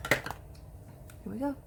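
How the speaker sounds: breathy whispered here we go. Real, not acted. Leaning close to the camera, hushed whisper. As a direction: whisper it as you lean in. Close-up to show waxed brows.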